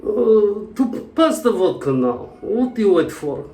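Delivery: high, put-on Russian accent. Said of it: "A Russian talking to a German".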